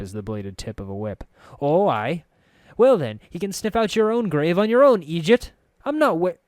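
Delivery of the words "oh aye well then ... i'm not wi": high, loud cutting retort; sharp snap. A narrator's reading. Anna snapping at the narrator, high and loud. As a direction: lash out like a whip. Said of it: "Her tongue snapped".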